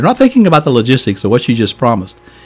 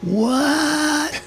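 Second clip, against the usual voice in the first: very high, softer, drawn-out stunned query; mock bewilderment; 5 dB quieter than usual. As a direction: imitate a baffled congregation, pitched way up. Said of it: "they looked at me like".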